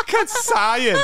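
high-pitched laughing disbelief. Real, not acted. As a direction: laugh through the words, amazed.

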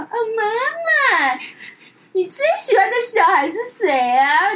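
high, slow sing-song coaxing of mom; playful high whine, half laughing; coaxing daughter. Retold or imitated. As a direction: sweet-talk mom, fishing for praise. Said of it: "撒娇".

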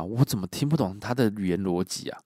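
ordinary delivery, nothing marked

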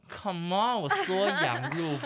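high, slow, hammed-up protest while laughing; mock indignation. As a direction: stretch it out in mock outrage. Mocking the netizens' accusation. (拉长声音假装愤慨地抗议)

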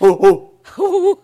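loud hooting gorilla call, high; gorilla grunts. Pretend. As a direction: hoot like a gorilla for the page turn. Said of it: "You should make a gorilla sound".

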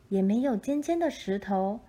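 ordinary delivery, nothing marked

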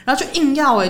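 usual speaking voice

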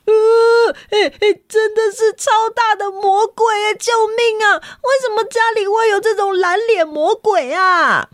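high, alarmed cry for help; storyteller shrieking in mock terror at the blue-faced devil; mock panic. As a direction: pretend to be scared of the devil.

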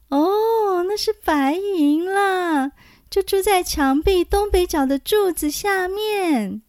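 thin high little voice. A narrator's reading. Xiyao voiced by narrator.

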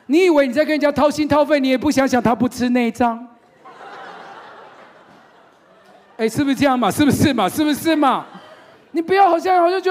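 high, loud laughing rebuke; loud teasing. Real, not acted. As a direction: tease the crowd at full volume. Preacher teasing congregation loudly.

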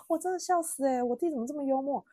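high-pitched, genuinely amused laughing speech; 真的笑死. Real, not acted. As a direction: crack up while talking about a funny brother. (忍不住笑着说，音调拔高)

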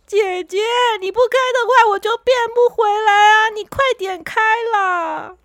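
little car crying for his sister to drive; crying car boy. Acted. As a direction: beg her through tears. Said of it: "小扑这时候又哭了".

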